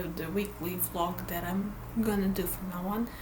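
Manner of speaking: ordinary delivery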